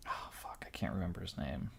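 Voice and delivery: hushed, unvoiced aside while hunting your memory; quick, barely audible mutter to yourself. Real, not acted. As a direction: whisper to yourself. Muttering to self, searching for name.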